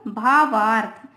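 normal talking voice

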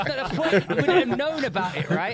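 high, laughing rant; giggling, high-pitched disbelief. Real, not acted. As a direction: push the words out through laughter.

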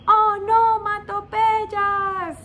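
onomatopeyas slow and high; stretched out. Shown as a demonstration. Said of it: "teacher stresses the term". (onomatopeyas muy lenta y aguda)

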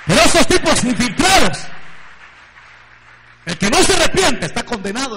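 loud, rising preacher's cry building to praise; preaching at full force. Acted. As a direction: proclaim it with rising fervour. (predicación a todo pulmón, cada vez más fuerte)

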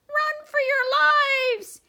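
very high, loud, slow scream of panic; screaming mice. Acted. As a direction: shriek like terrified tiny soldiers. Soldiers fleeing.

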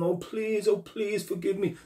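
high-pitched mock pleading; begging, pleading. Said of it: "would you want them begging and pleading".

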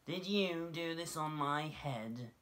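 high, slow, accusing mole voice; little mole asking. A narrator's reading. Mole questions the passing dove, slow high accusing voice. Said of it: "he asked the hare".

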